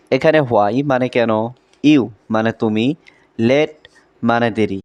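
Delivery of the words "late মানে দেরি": normal talking voice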